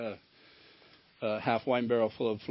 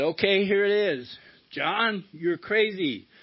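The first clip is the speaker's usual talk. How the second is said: higher, louder, as the ex-wife landing the blow; retold accusation; 7 semitones higher, 6 dB louder, slower than usual. Ex-wife finally tells John he's crazy, higher and louder. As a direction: deliver it as her cutting verdict.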